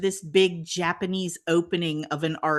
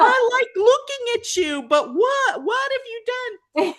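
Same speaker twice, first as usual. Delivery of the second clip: horrified Louis. Retold or imitated. Louis horrified at her hair.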